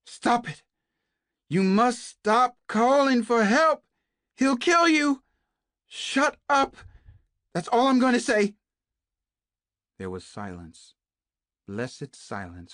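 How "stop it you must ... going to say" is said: much higher and louder, yelling to make her stop; frantic shout. A narrator's reading. Naomi yells at Kate to stop calling, frantic shout. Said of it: "shouted as loud as she could".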